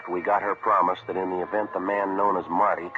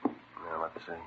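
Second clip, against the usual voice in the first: hushed; 14 dB quieter than usual. Acted. Detective watching the street from the window, hushed. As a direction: keep it down so the man outside won't hear.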